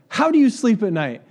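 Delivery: high, loud accusing jab; indignant retort. Retold or imitated. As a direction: throw it at the scammer. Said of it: "I was like".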